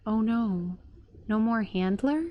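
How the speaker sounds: ordinary talk, nothing marked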